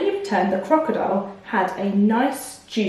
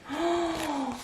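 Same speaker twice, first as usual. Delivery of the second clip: gasp; 7 semitones higher, 10 dB quieter than usual. Acted. Mouse sees the cracked tooth.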